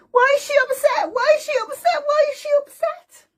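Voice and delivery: put-on very high squeaky baby; a worried little baby asking; tiny baby voice. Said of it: "Babies goes".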